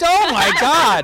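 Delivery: loud drawn-out gasp of shock; shocked exclamation. Real, not acted. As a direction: blurt it out in disbelief. Shocked outburst, loud, slow.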